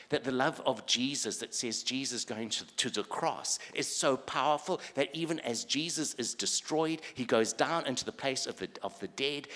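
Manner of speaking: urgent, high-energy proclamation; full-force preaching. Real, not acted. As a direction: preach it at full power without letting up.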